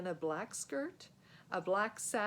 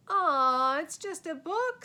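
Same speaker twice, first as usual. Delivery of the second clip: loud, drawn-out letdown; disappointed groan; 8 semitones higher, 11 dB louder, slower than usual. Acted. Fred groans disappointed at the book. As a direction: sag at the boring gift.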